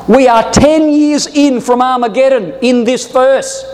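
ordinary talk

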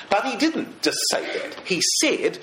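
ordinary talk

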